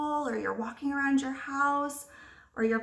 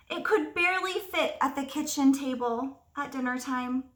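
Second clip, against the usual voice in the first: ordinary delivery, nothing marked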